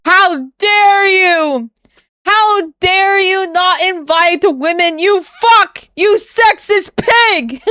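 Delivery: high, slow, hammed-up outraged shriek; mock fury at a sexist; shaking with anger. Mocking outraged critics of streamer.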